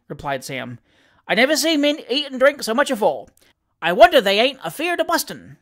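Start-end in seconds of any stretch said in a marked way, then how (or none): 1.3-5.6 animated Cockney manservant, higher-pitched; lively dialect servant reporting with relish; Sam Weller, cheeky manservant. Acted. Valet telling his master of the election, animated Cockney.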